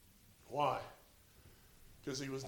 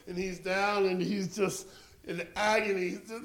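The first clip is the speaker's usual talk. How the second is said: ordinary talk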